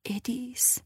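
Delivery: barely audible, breathless whisper; faint whisper. A narrator's reading. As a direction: whisper it like she's fading. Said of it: "زیر لب گفت".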